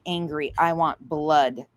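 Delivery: ordinary talk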